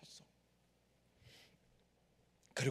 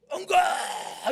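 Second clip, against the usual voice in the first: long, high, straining scream; agonised refusal scream; 14 semitones higher, 12 dB louder, much slower than usual. Retold or imitated. Dying man fights against going, long agonised scream. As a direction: scream it out, stretching the vowel. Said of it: "이래요".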